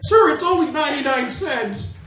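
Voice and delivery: very high, eager upsell voice; pizza phone kid. Retold or imitated. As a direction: chirp it like a teenage phone clerk pushing extras. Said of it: "This kid's on the phone".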